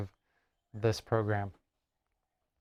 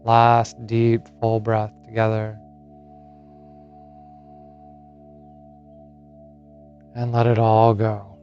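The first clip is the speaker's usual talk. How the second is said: guided meditation; 10 dB louder than usual. Acted. Yoga teacher settles listeners into Yoga Nidra, guided meditation. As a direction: lead them gently into rest, phrase by unhurried phrase.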